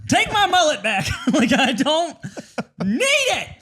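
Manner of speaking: laughing falsetto whine; mock whiny squeak. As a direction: squeal it like a pouty kid.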